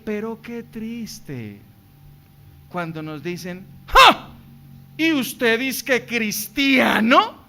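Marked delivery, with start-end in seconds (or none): 3.9-4.3 loud, high mocking ha; one sharp scoff; scornful ha. Mocking laugh of critics. 4.9-7.4 slow, sneering taunt; mocking taunt. Mocking critic.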